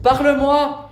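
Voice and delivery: high, loud insistent shout; shouted demand. Real, not acted. Educator pressing a silent young woman, shouted demand. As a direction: shout it as an order.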